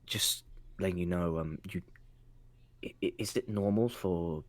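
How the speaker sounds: hushed, low, hesitant aside; halting, secretive undertone; confidential whisper. Acted. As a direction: lean in and ask discreetly, very softly. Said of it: "so he can just whisper something".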